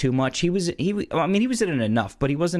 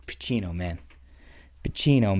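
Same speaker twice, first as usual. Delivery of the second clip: ordinary delivery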